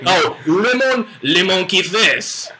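slow, hammed-up French accent on le monkey face; a bad French accent, played for laughs; mock French accent. Teasing his co-host with a hammed-up slow French accent. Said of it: "Your accents are so horrible".

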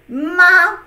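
Ma held high and level; first tone ma. Shown as a demonstration. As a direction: sing Ma on a high flat pitch. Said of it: "Let's sound this".

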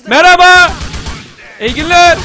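high, loud hello; shouted mock greeting. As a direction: yell a polite greeting as an attack. Greeting enemies while attacking. (bağırarak alaycı selam)

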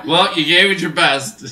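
high laugh into teasing words; laugh, then tease through it. Real, not acted. Laughing at friend's death.

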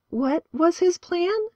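put-on high, slow girl's voice; curious girl. A narrator's reading. A girl asks the pastor his plan, high and slow. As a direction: ask breathlessly, hanging on his words.

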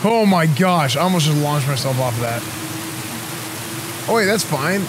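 normal talking voice

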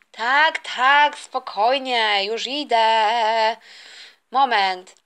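slow, drawn-out call back; unhurried reply. Acted. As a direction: drag out the words, in no rush. Son calls back, in no hurry.

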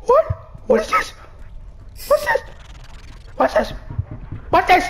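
very high, alarmed questioning; high-pitched panicked questions; panicked what. Real, not acted. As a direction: panic over the thing in front.

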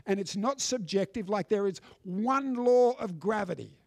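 ordinary talk

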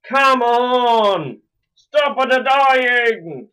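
real high, loud yell; frustrated yelling. Real, not acted. Gamer yelling at yet another death, high, loud and annoyed. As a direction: yell it out, stretching the key words. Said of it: "Sorry I'm yelling too".

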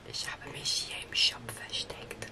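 hushed whisper while hiding. Real, not acted. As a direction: whisper so the seeker can't hear. Hiding from child during hide-and-seek.

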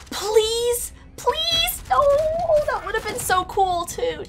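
high, drawn-out pleading into a wail; desperate wail. Real, not acted.

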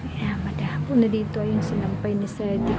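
soft whispered remark; voiceless hush, not to disturb. Real, not acted. Mom whispering to viewers that it's evening.

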